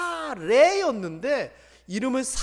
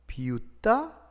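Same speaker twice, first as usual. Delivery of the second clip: usual speaking voice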